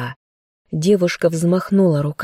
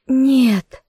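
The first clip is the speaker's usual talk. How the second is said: a higher, desperate cry of no; scream; 9 semitones higher than usual. Acted. Girl screams as her bear stops breathing. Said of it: "закричала она".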